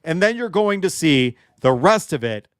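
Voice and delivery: usual speaking voice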